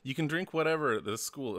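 ordinary delivery

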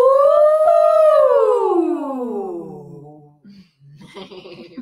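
big loud delighted laugh; long hearty laughter. Real, not acted. Laughing at student's high ooh.

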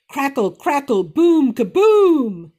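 loud slow crackling thunder; bellowing thunder. Acted. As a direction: bellow it like a storm. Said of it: "The thunder bellowed".

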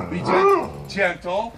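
loud, high sharp command; firm warning. Real, not acted. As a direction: stop the dog short with a firm call. Warning the dog.

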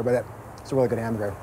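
words round a mouthful of burger; mouth full. Real, not acted. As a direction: still chewing while talking. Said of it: "I'm talking with my mouth full here".

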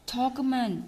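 ordinary talk

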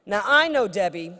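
ordinary talk

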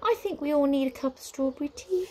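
high, breezy witch voice; airy. A narrator's reading. Witch changes subject to tea, airy. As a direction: suggest it lightly, pitched high. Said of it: "said the witch airily".

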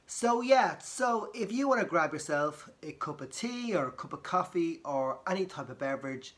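ordinary delivery

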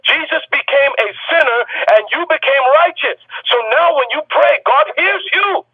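high, shouted sermon climax; preaching at full force. Acted. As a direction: lift the room with each "you better". Pastor at peak preaching.

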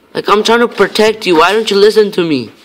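yelling at a dumb game truck that keeps wandering off; frustrated. Real, not acted. Gamer yells at the fire truck he must guard, frustrated. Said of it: "I'm getting pissed off now".

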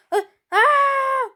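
high loud startled yelp; startled cry. Real, not acted. Gamer falling suddenly, crying out in shock. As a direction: yelp as you drop.

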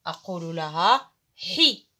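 حي stretched slowly as "ḥi"; teacher sounding a letter; ha with kasra. Shown as a demonstration. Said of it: "لديها كسرة".

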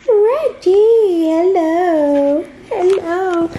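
loud, high sing-song hello to the dog; high pet greeting. Real, not acted. Greeting her dog Reggie in an excited, high pet voice.

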